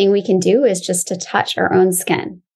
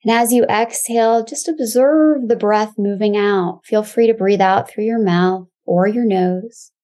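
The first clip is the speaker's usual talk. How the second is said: calm grounding exercise. Acted. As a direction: guide them gently into the body.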